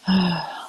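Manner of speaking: short audible exhale; real sigh. Real, not acted. Streamer about to sign off, audible sigh. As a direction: let out a sigh. Sighs before leaving the chat.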